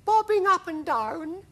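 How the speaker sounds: very high-pitched, polite questioning; a tiny, high ladybird voice; timid ladybird. Acted. Ladybird asking if they are bobbing, high timid voice. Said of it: "murmured the ladybird".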